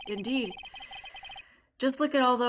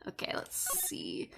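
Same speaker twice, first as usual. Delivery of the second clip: whisper; 7 semitones lower, 13 dB quieter than usual. Real, not acted. Quietly looking around.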